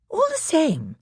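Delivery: put-on high thoughtful girl. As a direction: sensible older girl. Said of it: "said Susan presently".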